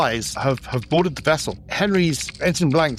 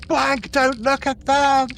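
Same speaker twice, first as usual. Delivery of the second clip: high, drawn-out acted scream; screamed warning; 12 semitones higher, slower than usual. Lieutenant warns ensign under attack, high slow scream. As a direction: scream it to a crewmate, stretching the words. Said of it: "she then screams".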